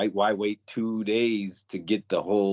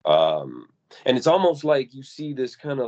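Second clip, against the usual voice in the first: ordinary delivery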